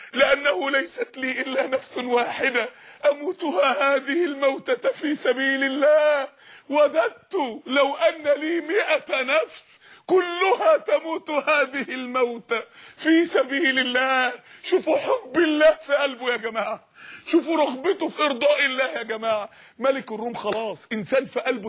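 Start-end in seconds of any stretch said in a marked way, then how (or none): 0.1-14.3 slow, choked-up recitation with a catch in the voice; tearful quote. Acted. Preacher to young men, slow and tearful. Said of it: "عايزك تحس بالكلمه دي قوي قوي". 14.6-19.5 tearful appeal. Real, not acted. Preacher moved to tears.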